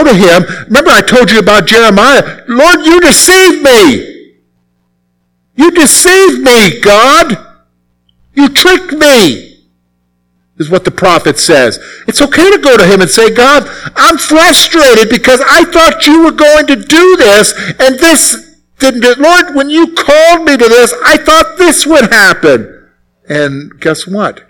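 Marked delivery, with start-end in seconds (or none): 2.5-9.7 higher, slow, pained complaint to God; anguished prophet. Retold or imitated. Voicing prophet Jeremiah's lament. 13.3-22.9 frustrated prayer. Retold or imitated. Voicing frustrated prayer to God.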